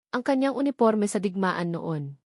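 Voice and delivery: ordinary delivery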